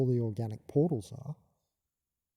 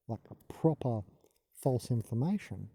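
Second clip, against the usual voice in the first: usual speaking voice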